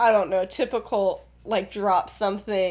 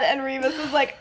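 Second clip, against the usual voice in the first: ordinary delivery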